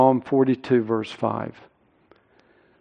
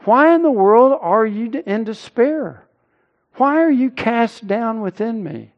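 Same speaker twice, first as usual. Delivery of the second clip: higher-pitched, incredulous questioning of oneself; self-interrogation; 8 semitones higher than usual. Acted. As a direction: challenge your own soul with pointed questions. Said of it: "begin to interrogate yourself and ask yourself".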